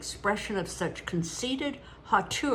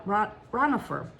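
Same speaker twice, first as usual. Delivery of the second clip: usual speaking voice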